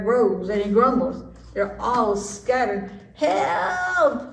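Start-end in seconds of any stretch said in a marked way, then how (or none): 3.1-4.2 high panicked yell; cry for help. Acted. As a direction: shout for help as you flee. Animals scattering in fear.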